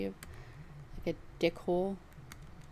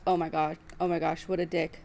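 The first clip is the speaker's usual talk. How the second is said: normal talking voice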